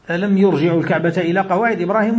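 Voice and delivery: usual speaking voice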